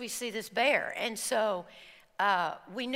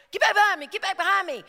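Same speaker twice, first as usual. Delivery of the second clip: loud, high, urgent order to get behind; urgent command; 8 semitones higher, 7 dB louder, much faster than usual. Retold or imitated. Instructor shouting at the group to get behind him. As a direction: bark it like an instructor shielding you. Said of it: "he went".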